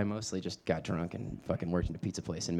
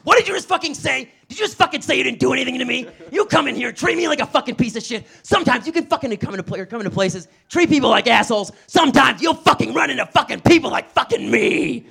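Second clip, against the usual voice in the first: much higher, louder furious shout; rage; 12 dB louder than usual. Retold or imitated. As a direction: explode at the customer, shouting. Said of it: "drop my whole act".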